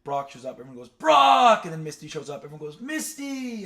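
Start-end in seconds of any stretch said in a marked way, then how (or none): 0.9-1.6 put-on loud high screaming kid crowd; loud, high, excited kids screaming a name. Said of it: "screaming them out". 2.8-3.6 crowd scream. Retold or imitated. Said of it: "screaming them out".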